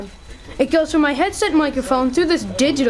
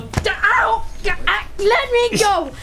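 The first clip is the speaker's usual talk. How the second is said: higher-pitched, pained struggle; struggling protest; 6 semitones higher, slower than usual. Acted. Caught in a scuffle, demanding release, struggling protest. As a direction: yelp it while fighting to get free.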